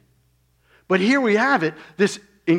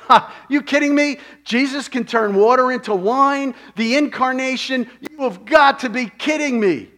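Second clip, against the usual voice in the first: much higher, louder, derisive mock laugh; scoffing laughter; 9 semitones higher, 7 dB louder than usual. The jeering crowd ridiculing Jesus's claim, derisive. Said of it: "And they're laughing".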